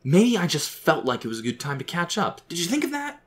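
high, heated retort; angry. A narrator's reading. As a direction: temper rising, pitch climbing to an indignant question. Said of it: "he said angrily".

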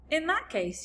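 put-on bright young girl; Sophie voice. A narrator's reading. Sophie character voice.

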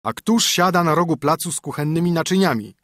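wyżej i głośniej, pouczający mąż; surowy mąż. A narrator's reading. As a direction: rozkazuj żonie jak zmęczony gospodarz. Husband reproaches her. (mąż pouczający żonę, głośniej i surowo)

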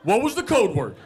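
high, loud, stammering outrage; acted indignant interrogation; exasperated demand. Berates roommate over code word.

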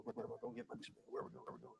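fast whispered fretting; worried whisper. Pretend. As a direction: whisper it like a lost anxious bird. Sparrow voiced, whispered.

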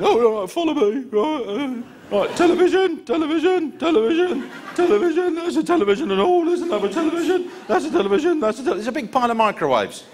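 high, giggly spotty lad listing TVs; squeaky teen clerk. Retold or imitated. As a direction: squeak through the aisles like a clueless kid. Teen clerk leading through shop.

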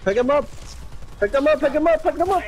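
rising urgent yell; urgent shouting. Real, not acted. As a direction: shout it across the map. Urging teammate during fight.

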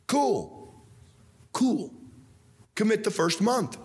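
high, easygoing okay; a pastor casually accepting the offer; breezy approval. Acted. Preacher approving a commitment.

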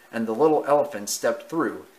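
ordinary delivery, nothing marked

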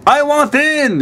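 high-pitched puppet frog impression; Kermit the Frog. Streamer showing viewers how to claim a spot, bad Kermit voice. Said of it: "in a Kermit the Frog voice".

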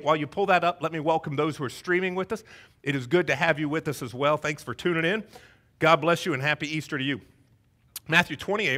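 normal talking voice

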